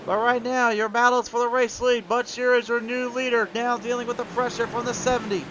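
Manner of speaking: high-pitched, punchy live race call; excited race play-by-play. Real, not acted. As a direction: call the overtake with rising excitement.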